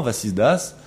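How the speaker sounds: normal talking voice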